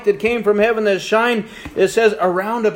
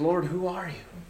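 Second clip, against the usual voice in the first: much softer, almost breathed out; hushed question; 11 dB quieter than usual. Acted. Saul lying on the ground asking the voice, hushed. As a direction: drop the voice to a hush, overwhelmed.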